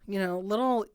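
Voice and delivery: ordinary delivery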